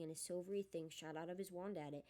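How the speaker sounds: ordinary delivery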